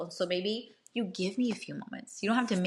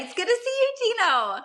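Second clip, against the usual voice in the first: much higher, bright welcome to a friend; happy high welcome to Gino again; warm greeting; 5 dB louder than usual. Real, not acted. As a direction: greet him happily, voice lifted high.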